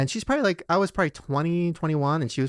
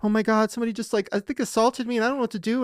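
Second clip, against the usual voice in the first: higher, rushed and frightened; lightly voiced panic; retold panic; 8 semitones higher, faster than usual. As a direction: relive calling for help, scared.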